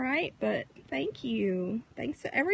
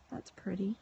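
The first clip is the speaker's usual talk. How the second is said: whisper; 5 semitones lower, 12 dB quieter than usual. Real, not acted. Whispered remark about clouds.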